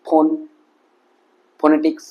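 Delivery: "ফোন" and "ফোনেটিক্স" starting on an aspirated p; lips closed p-h. Shown as a demonstration. Teacher demonstrating the PH sound, lips closed. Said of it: "তখন আমাদের ঠোঁটটা লেগে হবে".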